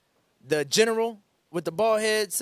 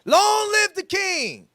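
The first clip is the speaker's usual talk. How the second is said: high, loud, slow hailing; royal acclamation; 13 semitones higher, 9 dB louder, slower than usual. Shown as a demonstration. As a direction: cry out to the king, drawn out. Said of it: "pastor demonstrates Hushai's cry".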